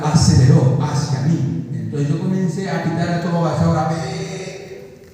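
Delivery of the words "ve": a short high honk sound from the mouth; quick mouth-made horn blast; imitated car horn. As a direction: beep like a car horn, softly mocked. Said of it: "mi bocina".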